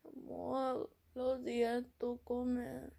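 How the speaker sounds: high, soft, slow plea; pleading boyfriend. Acted. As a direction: imitate a sick boyfriend begging gently. Sick boyfriend pleads in Spanish.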